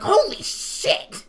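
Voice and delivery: slow, loud, high mock astonishment; shocked exclamation. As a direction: blurt it out as the stunned friend, drawn out. Friend shocked on hearing Peridot voice.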